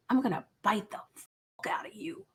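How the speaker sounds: hushed voiceless note reading; creepy whisper. Retold or imitated. Teller reading the stalker's note aloud, eerie whisper. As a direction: whisper it like a threat.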